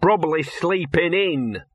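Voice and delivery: high, slow dog grumble; sullen grumble. A narrator's reading. Ignored dog among the legs, sullen. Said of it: "grumbled a sullen and totally ignored voice".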